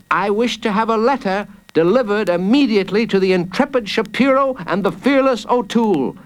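put-on quick, high-pitched child's demand; bold child. Jacob demands delivery of his letter, bold. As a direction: dictate terms like a confident child.

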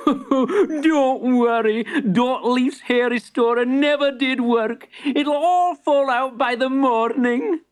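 high, slow, chuckling reassurance; laughing shopkeeper. Acted. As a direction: laugh while calming her.

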